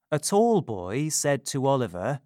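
ordinary delivery, nothing marked

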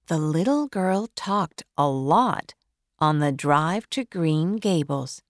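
ordinary delivery

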